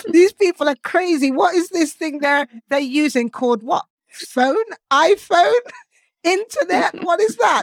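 high, loud mock bewilderment at modern gadgets; baffled old-timer. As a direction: play a baffled sixteenth-century person, hammed up. Imagined 16th-century people baffled.

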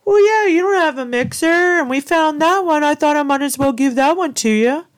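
very high, loud, whiny imitation; loud squeaky mockery of his excuse; mock husband excuse. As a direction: mimic him defending himself in a silly high voice. Mocks husband's excuse for gift.

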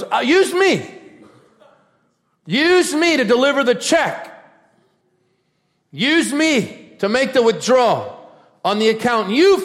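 high, loud preacher's plea; crying-out plea. Retold or imitated. A willing heart begging God to use it, loud impassioned cry. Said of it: "So a heart goes".

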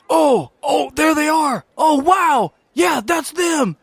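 spot them across the room and yell; thrilled student calling to friends; excited student shout. Acted. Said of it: "you hear a voice says".

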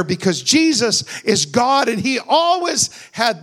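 loud, high fervent preaching; preaching at full force. Real, not acted. Preacher retelling the fiery furnace to the church, loud and fervent. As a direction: drive the story home from the pulpit. Voicing Jesus and the king, preached.

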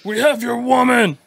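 very high, loud villain shout; Cookie Monster voice. Retold or imitated. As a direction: taunt him from the town square. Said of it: "like, Cookie Monster voice".

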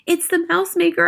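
put-on high-pitched, urgent boy's cry; excited cry. A narrator's reading. A mouse-turned boy to his grandmother as witches shrink, crying out excitedly. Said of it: "I cried".

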